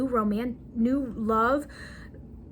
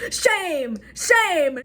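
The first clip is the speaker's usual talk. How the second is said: loud, higher cries of mock shame; mock shaming; 4 semitones higher, 5 dB louder than usual. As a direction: playfully chant it like a public shaming. Mock scolding herself over past relationships.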